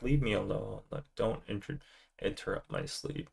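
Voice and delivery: very soft voice for a drowsy dog; hushed, low-energy pet voice; sleepy dog. Retold or imitated. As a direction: murmur as the dog wanting to be left alone. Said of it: "she's like".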